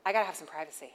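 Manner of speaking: playfully imitating her husband asking for privacy; lightly voiced husband. Wife mimicking her husband at the bathroom door, lightly voiced. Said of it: "he's like".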